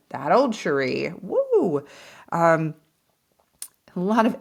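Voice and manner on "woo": high excited whoop. Real, not acted. Remembering her impulsive old self, excited whoop. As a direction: let out a playful high woo. Recalling wild past self.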